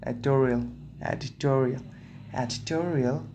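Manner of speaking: trying "Editorial" repeatedly in an American accent; American-style "Editorial", tested take after take. Shown as a demonstration. Reader practising how Americans would say editorial, American accent. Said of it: "in American accent".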